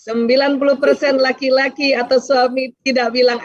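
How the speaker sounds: ordinary talk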